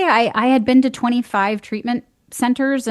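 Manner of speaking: normal talking voice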